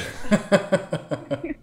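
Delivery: high, bright burst of laughter; a short rising laugh, moved and a little embarrassed; tearful laugh. Real, not acted. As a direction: laugh through the tears. Emotional host laughs after tearing up.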